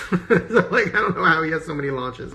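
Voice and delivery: high bursts of real laughter through the words; laughing. Real, not acted. Creator laughing to fans about so many launches, laughing loudly.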